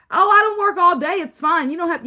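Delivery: high, loud sweet neighbor voice; sugary high-pitched neighbor offer. Retold or imitated. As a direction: chirp it like a kindly neighbor.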